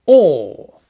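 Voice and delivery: British OR, long vowel without r; British accent. Shown as a demonstration. Teacher modelling the word for pupils, British accent. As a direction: draw out "or" the British way, r silent. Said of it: "Avec un accent britannique".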